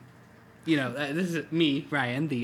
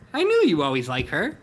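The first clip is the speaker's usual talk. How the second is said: teasing tiger voice; 7 dB louder than usual. Acted. Repeats line in Hobbs voice.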